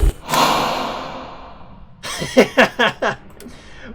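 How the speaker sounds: loud gasp into high laughter; startled laugh. Real, not acted. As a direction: burst out laughing after a scare. Laughs off the jump scare.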